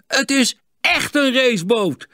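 heel hoog en luid, opgetogen; juichende schreeuw. A narrator's reading. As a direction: schreeuw het uit als uitgelaten jongens. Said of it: "schreeuwden de jongens tegelijk". (uitgelaten geschreeuw)

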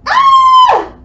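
long, very high, loud scream; sustained full-volume shriek on "ah". Shown as a demonstration. Teacher acts out the command to scream, piercing high scream.

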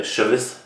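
"Chvese" as two syllables, SHIV-iss; unsure pronunciation. Real, not acted. Reactor puzzling over an artist's name, unsure pronunciation. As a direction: try "Chvese" as SHIV-iss, questioning rise. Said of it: "how to say this guy's name".

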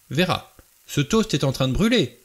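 high, loud, scandalised scolding; outraged. A narrator's reading. Emily Brent to Vera about burning toast, outraged. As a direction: scold in an affronted tone, pitch up. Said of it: "d'un ton outré".

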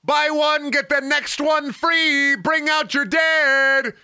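plague cart crier. Acted. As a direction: bellow like a medieval street seller. Plague cart crier, very high slow.

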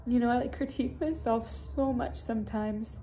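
ordinary talk

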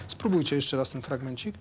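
normal talking voice

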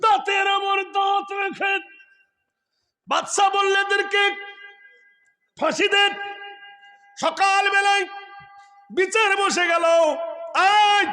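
high, loud shouted storytelling; thundering sermon delivery of a king's verdict; full-force preaching. Real, not acted. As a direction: shout each short phrase separately, high-pitched.

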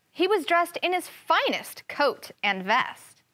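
put-on lively read-aloud for kids; story narration. A narrator's reading. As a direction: tell the fable with storybook rise and fall. Teacher reads fable aloud.

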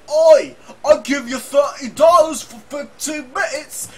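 high, slow shouted Cockney. Retold or imitated. Propositioning someone on the phone, shouted bad Cockney. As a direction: holler it in a terrible mock Cockney.

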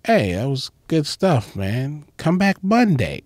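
lightly voiced proper host, slowed; a hint of a prim, over-enunciated Tony; comic retelling the host inviting him back, mild proper voice; imitating Tony. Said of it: "he talks very proper".

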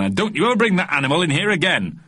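high, scolding telling-off; growled warning. A narrator's reading. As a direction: warn them off indignantly. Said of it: "growled the bristly man".

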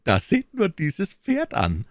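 high, slow cry of surprise; amazed exclamation. A narrator's reading. D'Artagnan showing friends the horse, exclaiming in surprise. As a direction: draw it out high, marvelling. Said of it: "rief er".

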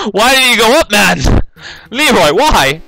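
high, loud protest with a laugh in it; exasperated wail. Real, not acted. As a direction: wail at your game character in despair. Wailing at character's mistake.

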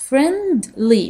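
deliberate separate beats in "Friendly"; syllable by syllable. Shown as a demonstration. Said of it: "Three syllables". (مقطعًا مقطعًا)

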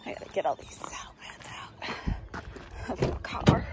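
hushed quick whisper. Real, not acted. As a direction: mutter it under your breath. Whispered aside, unvoiced.

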